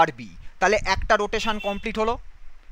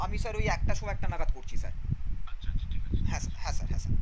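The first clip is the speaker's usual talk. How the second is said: hushed aside into the phone; whispering on the phone; 3 semitones lower, 5 dB quieter, much faster than usual. Real, not acted. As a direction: quietly answer a call mid-lesson. Teacher takes a phone call in class.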